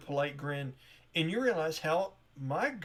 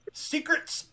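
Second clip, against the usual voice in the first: mock secret voice; 13 semitones higher, 5 dB louder than usual. GM mocking top secret.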